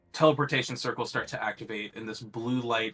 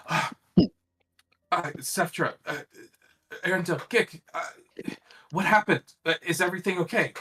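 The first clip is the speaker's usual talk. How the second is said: worried priest; 8 semitones higher, 5 dB louder, faster than usual. Acted. GM voices worried Cassian arriving.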